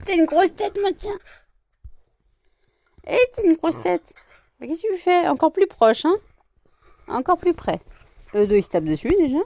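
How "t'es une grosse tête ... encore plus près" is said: high, loud doting pet voice. Real, not acted. Owner to her dog, high doting baby talk. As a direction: coo at the dog.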